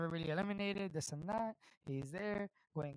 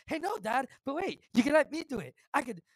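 high, hurried pleading son; Shane O'Mac; 8 semitones higher, 6 dB louder than usual. Acted. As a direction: butt in eagerly, begging Dad for the chance. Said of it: "Shane O'Mac enters the room".

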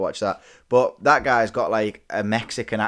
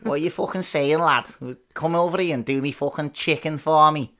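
higher, put-on ranting Scouser; angry Scouse accent; 4 semitones higher than usual. Shown as a demonstration. Angry Scouser demanding chicken, mock Scouse accent. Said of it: "an angry Scouser".

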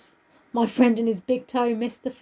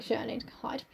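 much softer, hushed thought; hushed panic; 13 dB quieter, faster than usual. Pretend. Mary realising she must hide, hushed. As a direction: breathe it like you're hiding.

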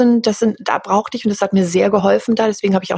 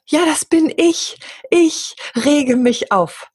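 much higher, slow mock indignation; self-mocking; 8 semitones higher, much slower than usual. Mocking herself for getting worked up, slow and high. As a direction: ham up your own outrage.

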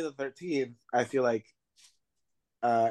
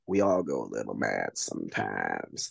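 sinister killer. Retold or imitated. Billy turns around and reveals himself, sinister killer. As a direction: drop way down for a menacing movie quote.

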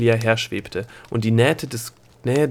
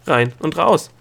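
high, snippy punchline; pert; 5 semitones higher, 6 dB louder than usual. A narrator's reading. Girl listing the only two directions, pert. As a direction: toss it off cheekily. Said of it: "sagte Delia schnippisch".

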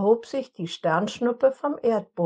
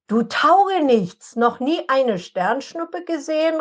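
higher, louder furious scolding; angry call; 7 semitones higher, 4 dB louder than usual. A narrator's reading. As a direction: tell off the good-for-nothing. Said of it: "rief ihm Jesse zornig zu".

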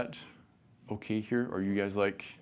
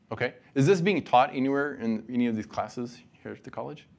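ordinary talk

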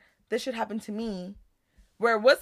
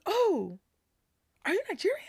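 lightly voiced eager man, higher pitch; mocking the man; 11 semitones higher than usual. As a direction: play the pushy older guy, eager and high. Man hitting on her, voiced.

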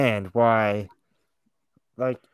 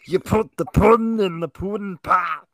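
high, loud, put-on comic voice; terrible Bill Cosby impression; 8 semitones higher, 5 dB louder than usual. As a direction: ham up a bad celebrity impression. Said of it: "my terrible impression".

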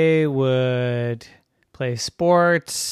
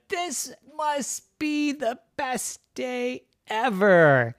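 put-on high, slow, delighted kid voice; blissful kids; 11 semitones higher, slower than usual. A narrator's reading. Boys sitting in maple syrup rain, blissful. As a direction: draw it out in high-pitched bliss.

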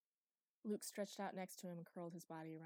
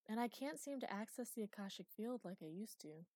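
ordinary delivery, nothing marked

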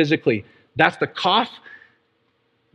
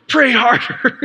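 higher-pitched mock urging; hammed-up exhortation, pitched up; imitated congregation; 5 semitones higher, slower than usual. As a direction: playfully imitate eager listeners egging you on. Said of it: "Some of you guys are going".

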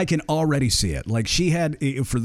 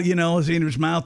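ordinary talk

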